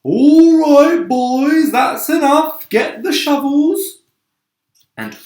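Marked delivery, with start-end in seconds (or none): 0.0-4.0 much higher and louder, a calling-out shout; shouting foreman. Acted. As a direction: yell across the cloud to the crew. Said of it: "heard him shouting".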